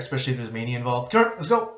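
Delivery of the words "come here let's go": loud, high, urgent commands; forceful rallying call, pitched way up; blunt and direct. Shown as a demonstration. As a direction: order someone to move right now, insistently. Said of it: "you have to be that blunt and direct".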